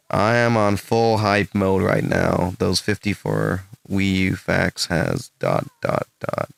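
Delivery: slow, deliberate attempt at an American accent. Shown as a demonstration. Streamer reading out posts to demo his accent, slow American accent. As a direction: read it out slowly in a put-on American accent. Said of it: "can you do your best American accent".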